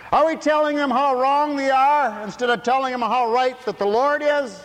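high, loud fiery preaching; shouted preaching. Acted. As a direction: thunder it from the pulpit. Preaching at full force.

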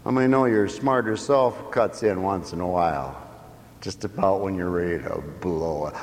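ordinary talk, nothing marked

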